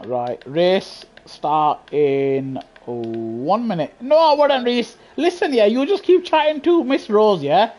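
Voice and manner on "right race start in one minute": announcer drawl. Acted. Announcing race start to lobby.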